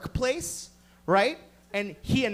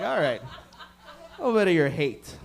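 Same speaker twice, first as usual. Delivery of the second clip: slow, laughing, lower voice; laughing mock resignation; 5 semitones lower, slower than usual. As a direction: chuckle through a mock shrug. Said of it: "and I'm like".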